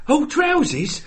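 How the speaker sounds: very high mock English; bad English accent. Echoing the friend, high silly English accent. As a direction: squeal it in fake English. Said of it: "trying to do an English accent".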